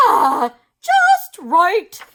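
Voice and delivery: slow, loud and pleased; gleeful doctor. A narrator's reading. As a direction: savour it slowly. Doctor satisfied.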